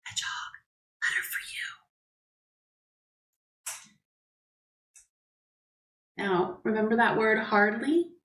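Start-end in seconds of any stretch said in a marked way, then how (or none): 0.1-6.3 barely audible breathy whisper; shy mailman whispering at the door. A narrator's reading. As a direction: whisper it so even he can hardly hear. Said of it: "He whispers so quietly".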